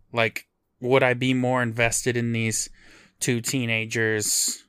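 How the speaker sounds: ordinary talk, nothing marked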